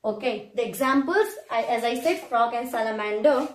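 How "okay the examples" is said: ordinary talk, nothing marked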